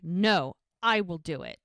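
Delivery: loud, firm refusal in a retelling; acted-out stern, emphatic insistence; brusque insistence. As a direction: overrule them forcefully. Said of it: "kind of an ass".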